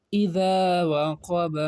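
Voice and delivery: very slow, drawn-out recitation; teacher modelling fluent reading slowly; slow Quranic reading. Shown as a demonstration. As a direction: draw out the phrase for pupils to follow. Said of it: "اب روا میں کیسے پڑھیں گے".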